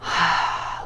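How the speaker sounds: soft unvoiced haa out the open mouth; breathy sigh. Shown as a demonstration. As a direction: exhale a quiet whispered ah. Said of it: "Just exhale".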